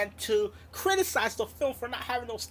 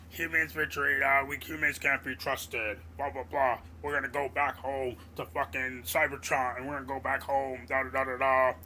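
moping Optimus; 10 semitones lower than usual. Retold or imitated. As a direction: drag it out low like a sulking hero. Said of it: "crying and moping about".

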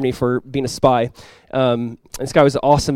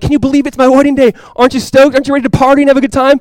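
high, loud, gushing friend at his wedding; excited friend; 8 semitones higher, 5 dB louder than usual. Retold or imitated. Groom hyping up his friend, high and excited. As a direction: play the thrilled groom hyping you up.

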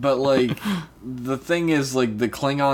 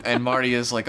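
ordinary delivery

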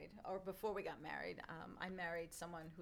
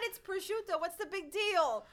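like it's no big deal, pitched up; dismissive kid; 11 semitones higher, 9 dB louder than usual. Retold or imitated. Young host telling her friends it's just ham, high and breezy.

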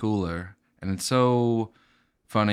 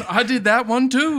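much higher and louder joke voice; high loud mock voice; put-on caricature voice; 7 dB louder than usual. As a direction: keep the silly boast voice going. Bit continues.

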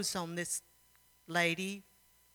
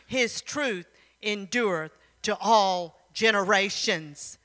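loud, measured psalm declamation; rousing, deliberate Bible reading; emphatic scripture reading; 6 dB louder than usual. Acted. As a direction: proclaim each verse with punched key words. Reads a psalm aloud.

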